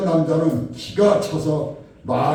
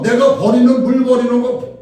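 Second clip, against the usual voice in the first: high, loud, combative yell; shouted challenge; 8 semitones higher, 8 dB louder than usual. Retold or imitated. Woman challenges the man over the thrown water, shouted retort. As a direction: throw the question back at full volume. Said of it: "소리를 지르는 거예요".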